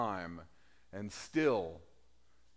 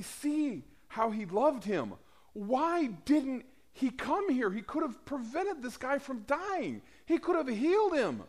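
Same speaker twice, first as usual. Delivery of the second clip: mourners' complaint; 11 semitones higher than usual. Retold or imitated. Crowd questions Jesus' delay.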